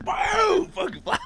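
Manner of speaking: high, strained burst; excited imitation gunshot. Retelling an old lady firing a huge handgun, excited. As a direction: let the bang leap way up in pitch.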